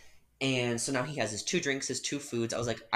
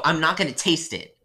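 loud, indignant no; firm refusal; 5 semitones higher, 7 dB louder than usual. Retold or imitated. Barista refusing the customer again, loud. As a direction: refuse him flat out, louder.

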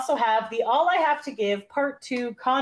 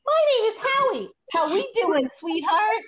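high-pitched Mickey voice; Howie; 12 semitones higher, 3 dB louder than usual. Acted. Howie signing off to a sweetheart, high Mickey voice. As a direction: croon a greeting in a cartoon squeak. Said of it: "I give Howie the high-pitched Mickey voice".

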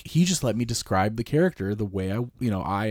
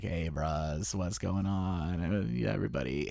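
low, soft, lightly put-on bro voice; funny character voice; 4 semitones lower, 11 dB quieter than usual. Wife's new character greeting the party, low funny voice. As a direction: drop pitch and volume for a laid-back imitation. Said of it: "with the kind of a funny voice".

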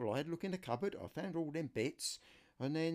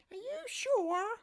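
much higher falsetto voice for his niece; niece's voice; 5 dB louder, slower than usual. Retold or imitated. Niece phoning her uncle for help, high falsetto. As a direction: play the niece asking a favour, pitched way up. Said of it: "this is my niece Laura".

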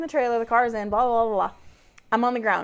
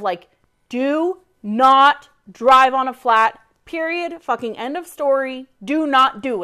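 slow, pointed warning; emphatic rule; 2 semitones higher, 5 dB louder than usual. Real, not acted. Stating her own rule to herself, emphatic. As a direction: lay down the law, word by word. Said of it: "this P T S D of".

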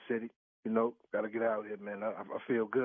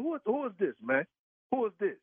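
higher, heated demand; angry demand; 8 semitones higher than usual. Real, not acted. Husband angrily demands who is calling. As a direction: press him hard for a name.

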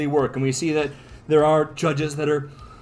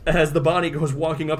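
normal talking voice

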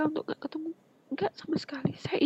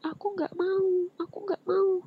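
higher, drawn-out wail of refusal; retold tantrum cry, pitched up and stretched; imitated child's yell; 5 semitones higher, 4 dB louder, slower than usual. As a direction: act out a child crying out in protest. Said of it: "Glesot itu sambil teriak-teriak".

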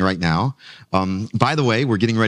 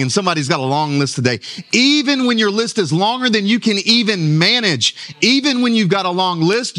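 higher-pitched, driving sermon delivery; emphatic preaching; 7 semitones higher than usual. Real, not acted. Preacher telling congregation where peace is found, emphatic preaching. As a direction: preach it with rising insistence, leaning on key words.

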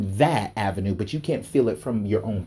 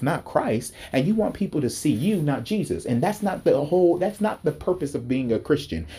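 ordinary talk

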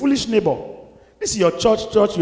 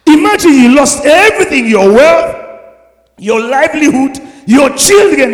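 loud, high ringing list; preaching climax; 6 semitones higher, 11 dB louder than usual. Real, not acted. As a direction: build each item louder. Preacher builds emotional climax.

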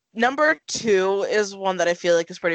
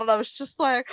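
usual speaking voice

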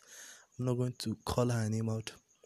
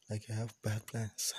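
whispering on the phone; 9 dB quieter than usual. Real, not acted. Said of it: "I'm whispering into my phone".

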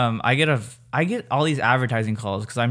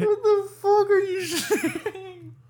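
laughing disbelief; slower than usual. Real, not acted.

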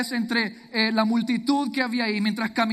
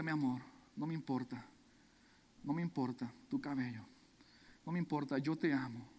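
much softer and lower, gentle "no me importa", soothing; tender whisper; 7 semitones lower, 13 dB quieter than usual. Acted. Hosea tenderly reassuring his broken wife after buying her. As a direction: comfort her softly, close to her ear.

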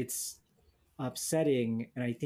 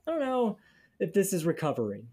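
incredulous; 6 semitones higher, faster than usual. Retold or imitated. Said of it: "And I was like".

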